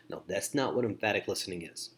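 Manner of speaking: ordinary delivery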